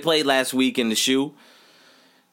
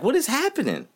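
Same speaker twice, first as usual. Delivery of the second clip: higher, incredulous question; exasperated disbelief; 14 semitones higher than usual. Real, not acted. Fan asking about his team's defense, incredulous. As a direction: ask it like you can't believe it.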